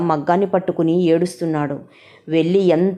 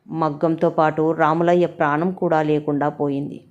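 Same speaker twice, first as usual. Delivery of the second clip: ordinary delivery, nothing marked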